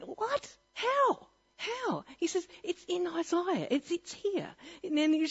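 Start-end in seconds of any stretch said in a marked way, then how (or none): 0.0-2.0 high-pitched, baffled What and How; mock bewildered outburst; incredulous. Disbelief at the preacher's claim. 2.6-4.5 lightly voiced eager believer, pitched higher; a hint of an insistent street preacher; pointing to scripture, mildly raised.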